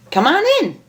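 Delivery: sing-song invitation at the door; Pinkie Pie voice. Acted.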